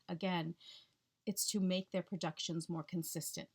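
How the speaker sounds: ordinary talk, nothing marked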